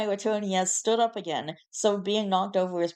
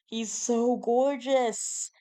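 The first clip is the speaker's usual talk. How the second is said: high, drawn-out cooing; adoring gush; 5 semitones higher, much slower than usual. Real, not acted. Seeing the cat stretched on the bed, adoring squeal. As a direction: gush slowly over something irresistibly cute. Gushing over a photo of the cat.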